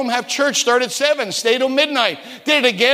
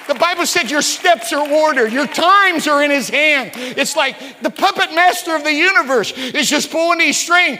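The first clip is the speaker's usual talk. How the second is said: preaching climax; 5 semitones higher, 3 dB louder than usual. Real, not acted. Preacher telling the congregation their steps are ordered, shouting. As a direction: rise to the altar-call peak.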